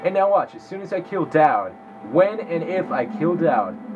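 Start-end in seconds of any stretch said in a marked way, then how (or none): none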